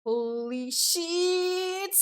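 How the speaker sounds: long drawn-out, high-pitched exclamation; slow, high, wowed reaction; awed amazement. Real, not acted. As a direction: stretch each word out high in amazement.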